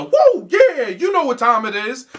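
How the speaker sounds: high, loud hype-man shout; a cartoon party DJ calling out; imitating Lucio. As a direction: play a nineties DJ caricature. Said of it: "nineties caricature of a fucking character".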